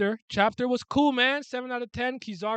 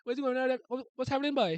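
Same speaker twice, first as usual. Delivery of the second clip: higher, sing-song Trinidadian accent impression; light Trinidadian accent, pitched up; 8 semitones higher than usual. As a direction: mimic a Trini relative greeting in Trinidadian accent. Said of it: "Trini's like".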